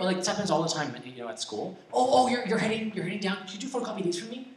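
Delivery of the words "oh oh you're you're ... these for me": high-pitched imitation of a pushy colleague; coworker asking a favor. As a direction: imitate a workmate slipping in a request. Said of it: "people at work ask you to do favors".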